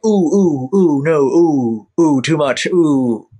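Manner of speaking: mock-wincing producer going ooh, slow; fussy network exec cringing at a heavy accent. Network creators recoiling at a heavy accent, mock-wincing. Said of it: "they're like".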